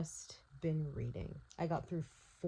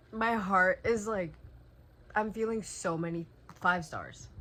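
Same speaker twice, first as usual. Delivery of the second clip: overwhelmed outburst; 3 semitones higher, 8 dB louder than usual. Real, not acted. Just finished the book, overwhelmed.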